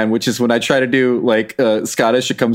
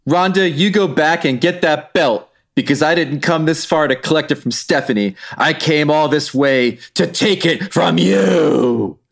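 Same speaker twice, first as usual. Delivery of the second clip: hammed-up interpretive reading, raised pitch; Becky Lynch; 4 semitones higher than usual. Co-host as Becky challenging Ronda for the belt, theatrical challenge. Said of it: "join me in an interpretive reading".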